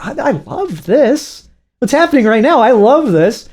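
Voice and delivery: high-pitched giddy amazement; delighted viewer. Retold or imitated. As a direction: gush in wonder, voice lifted high. Acts viewer's delighted reaction while watching.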